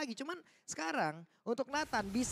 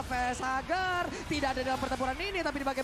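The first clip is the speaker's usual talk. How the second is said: play-by-play commentary =29; 5 semitones higher, 5 dB louder than usual. Acted. Live esports play-by-play.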